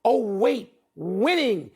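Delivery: normal talking voice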